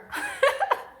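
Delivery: short high breathy laugh; quick amused laugh. Real, not acted. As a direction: burst into a laugh.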